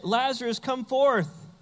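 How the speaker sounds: commanding call. Acted. Jesus calling Lazarus out of the tomb, commanding. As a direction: call him out with authority.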